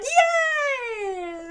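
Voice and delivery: pure fan relief, squeal it; thrilled cheer. Retold or imitated. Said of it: "I'm like".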